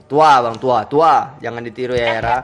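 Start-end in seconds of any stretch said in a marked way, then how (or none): none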